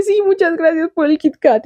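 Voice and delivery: high, put-on little voice; mock-sweet thanks. Nico accepting Will's Kit Kat after their fight, high mock-sweet voice.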